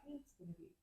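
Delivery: very soft, lullaby-like reassurance; imitating a soothing mother. Shown as a demonstration. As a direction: murmur it as if soothing a child. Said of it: "like, a mother is soothing".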